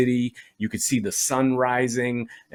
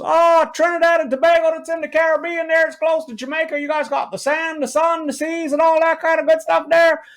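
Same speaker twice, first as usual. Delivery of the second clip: put-on high Newfoundland accent, chatty and pleased; drunk Newfie. Drunk Newfie telling the comedian about the Caribbean, high Newfoundland accent. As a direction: rattle it off like a drunk know-it-all from Newfoundland. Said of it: "And he goes".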